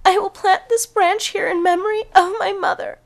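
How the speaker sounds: high, crying voice; tearful. Acted. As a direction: weep over your mother's memory. Cinderella grieving mother, crying.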